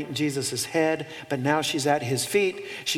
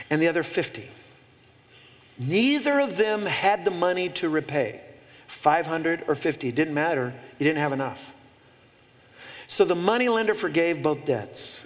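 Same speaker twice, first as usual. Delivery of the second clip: ordinary talk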